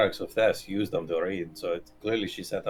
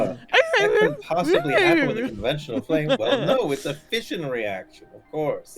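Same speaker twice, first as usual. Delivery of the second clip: much higher, loud, laughing dog voice; squeaky dog voice; 5 dB louder than usual. Acted. Joins in as a dog.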